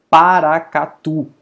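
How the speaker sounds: Paracatu slow and loud, weight on TU; stress on final syllable. Shown as a demonstration. Teacher giving an oxytone example, Paracatu stressed on "tu". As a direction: draw out Paracatu, punching the final "tu". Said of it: "a última sílaba é forte".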